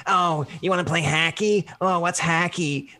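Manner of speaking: put-on Boston accent teasing "hacky"; mock Boston accent. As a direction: tease a Boston accent saying hockey as hacky. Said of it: "there's a part of me that goes like".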